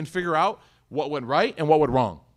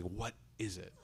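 much softer, hushed question through a laugh; secret whisper; 8 semitones lower, 17 dB quieter than usual. Retold or imitated. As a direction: ask under your breath, trying not to laugh. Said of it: "secretly looking, she's like".

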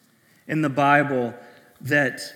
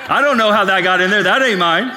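laughing panicked denial; 5 semitones higher, 9 dB louder than usual. Retold or imitated. Retelling panicked denial at customs.

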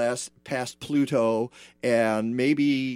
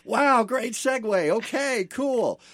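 sarcastic high mock voice; 11 semitones higher, 3 dB louder, slower than usual. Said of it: "Randall's like".

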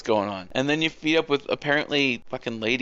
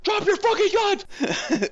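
very high, drawn-out imitation yell; mock yelled order; slower than usual. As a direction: imitate panicked agents ordering her down. Imitates everybody yelling at the intruder.

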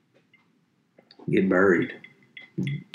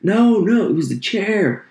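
much higher, louder panicked denial; defensive kid; 14 semitones higher, 6 dB louder, slower than usual. Retold or imitated. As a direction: squeal the excuse like a caught kid. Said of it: "And then you're like".

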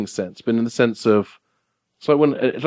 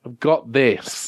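slow self-affirmation; much slower than usual. Acted. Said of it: "[name], are you a robot?".

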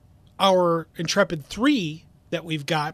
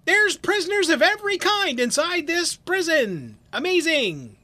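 much higher, wide-eyed wonder; mock amazement; 11 semitones higher than usual. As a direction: marvel at the obvious. Mock awed comment.

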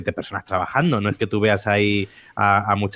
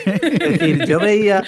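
laughing; 10 semitones higher, 4 dB louder, slower than usual. Real, not acted. Recalls own doubt amid laughter.

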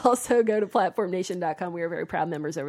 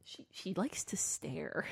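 whispered; 3 semitones lower, 14 dB quieter, slower than usual. Real, not acted. Whispered about the cat.

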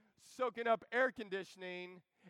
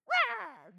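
tiny roar; 10 semitones higher than usual. Retold or imitated. Cub tries to roar.

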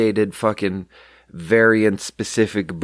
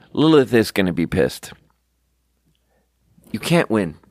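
normal talking voice

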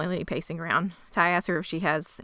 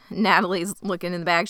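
ordinary delivery

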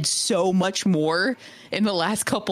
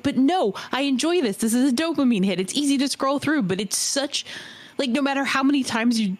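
ordinary delivery

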